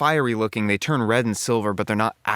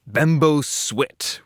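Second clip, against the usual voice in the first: slow sound effect; 4 semitones higher, much slower than usual. Shown as a demonstration. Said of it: "reading sound effects".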